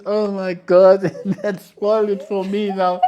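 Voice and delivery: amused outburst, laughing. Real, not acted. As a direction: laugh as you say it. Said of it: "And I said".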